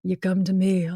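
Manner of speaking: a hint of Don Corleone; half-hearted gangster voice; bad Godfather impression. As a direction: mumble it like a mob boss, only lightly. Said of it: "Sorry, that was bad".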